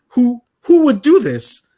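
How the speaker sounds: rising disbelief, breaking into a high laugh; baffled disbelief. Retold or imitated. Gamer retelling a baffling game moment, incredulous. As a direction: ask it like you can't believe it.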